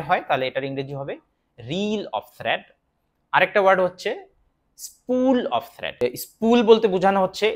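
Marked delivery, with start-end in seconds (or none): 1.6-2.6 slow model phrase. Shown as a demonstration. Teacher to learners, very slow model read. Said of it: "teacher models English phrase". 4.9-5.9 spool of thread very slow and higher; slow model phrase. Shown as a demonstration. Teacher to learners, slow high model read. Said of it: "teacher models English phrase".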